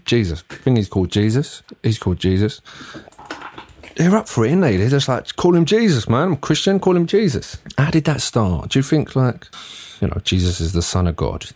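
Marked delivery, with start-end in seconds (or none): 5.4-7.6 high-pitched, breezy enthusiasm; acted-out keen believer; eager insistence. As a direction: pitch it up, urging them on. Voices Christians eager for name.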